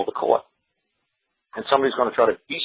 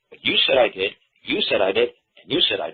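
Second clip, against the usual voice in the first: "you" punched every time, jabbing at each accuser; "you said I did", with the "you" stressed, pointing at each. Real, not acted.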